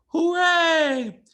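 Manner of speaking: very high, loud hooray; a big squeal of celebration; cheering crowd. Acted. Crowd cheering Ray's skills, very high and loud. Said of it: "skills were applauded".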